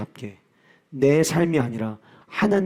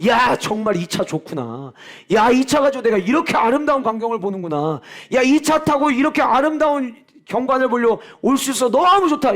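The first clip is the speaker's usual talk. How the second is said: rising higher and louder in delight; delighted car owner; 8 semitones higher, 4 dB louder than usual. Acted. Preacher voicing a thrilled car owner, building higher and louder. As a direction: gush over the new car, building to a cry.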